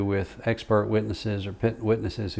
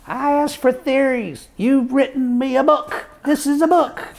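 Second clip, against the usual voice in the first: high, put-on voice of an exasperated old judge; old judge, fed up with a thick brief; imitating the judge; 4 dB louder than usual. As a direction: mimic the judge scolding the lawyer. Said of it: "And he says".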